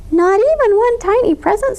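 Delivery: high pleading squeak; a tiny mouse begging for one present; squeaky mouse. A narrator's reading. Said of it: "squeaked Mouse".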